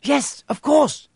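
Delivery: high, loud, slow; girl flustered by the answer; flustered realisation. Acted. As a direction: realise it all at once. Hermione realising.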